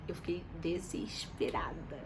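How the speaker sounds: breathy whisper of despair. Real, not acted. Telling viewers how panicked she felt, whispered. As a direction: hush it like a confession.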